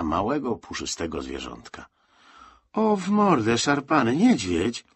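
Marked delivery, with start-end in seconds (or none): 2.7-4.8 high, loud amazed exclamation; high-pitched astonishment, drawn out at the end; astonished. A narrator's reading. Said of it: "zdumiał się kapral Stasik".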